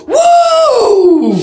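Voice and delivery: high whooping cheer, full volume; cheer shout. Real, not acted. Fan whooping for his team, loud high cheer. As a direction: let out a big fan whoop. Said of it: "everybody's been waiting for me to do my cheer".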